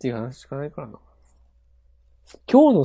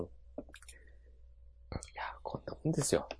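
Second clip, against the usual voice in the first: fast, hushed whisper; 18 dB quieter, faster than usual. Real, not acted. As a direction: whisper it quickly under your breath. Whispered aside, then repeated aloud.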